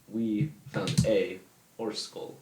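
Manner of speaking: slow, separated words, "a" said as "ay"; half-speed, deliberate enunciation with a full "a"; careful repeat. Real, not acted. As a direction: spell it out word by word for a mishearing listener. Repeats slowly after being misheard.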